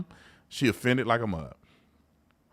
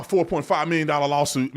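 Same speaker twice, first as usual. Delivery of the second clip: usual speaking voice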